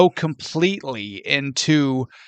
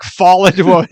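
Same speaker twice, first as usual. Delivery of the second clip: ordinary talk, nothing marked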